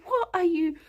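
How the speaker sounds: mock-baffled, giggling through it; incredulous disbelief. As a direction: react like you can't believe your ears. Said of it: "And I was just like".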